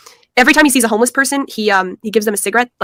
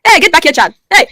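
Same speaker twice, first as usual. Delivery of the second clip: put-on loud Nigerian accent, higher pitch; 6 semitones higher, 6 dB louder than usual. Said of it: "do the African accent".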